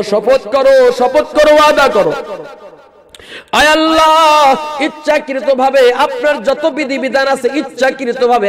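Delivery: high, loud, chanted appeal; full-force preaching. Acted. Preacher pleading with the young men, high and loud. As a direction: cry out to the young men in a sung pitch. Leads a vow.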